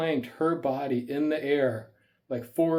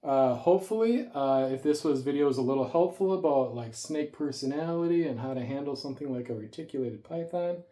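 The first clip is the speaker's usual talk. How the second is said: usual speaking voice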